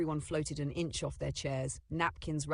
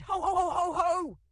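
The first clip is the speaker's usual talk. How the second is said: high, loud ho-ho belly laugh; jolly grandpa laugh; 6 dB louder than usual. A narrator's reading. Scream swapped for grandpa's laugh, jolly. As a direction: let out a hearty, jolly laugh. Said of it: "Grandpa's jolly laugh echoed instead".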